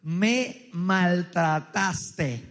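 very slow, syllable by syllable; weighty accusation. Retold or imitated. Comedian quotes woman's devastating word to man, slow mock accusation. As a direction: drop each syllable like a bomb, mock-dramatic. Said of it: "Ella va a utilizar una palabra masiva".

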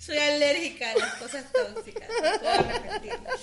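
giggling through the words, high and loud; can't stop laughing while trying to speak. Real, not acted. Laughing fit over a blooper.

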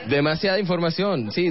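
ordinary delivery

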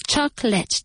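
"Chocolate" as choc-lit, two crisp beats; teacher's careful CHOC-lit; two syllables. Shown as a demonstration. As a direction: say "Chocolate" with no middle vowel. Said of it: "Two syllables".

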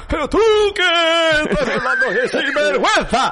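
high-pitched mock outrage; hammed-up indignant squeal; mock indignation. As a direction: pipe it up high in playful disbelief. Mocking an imagined reply to the insult.

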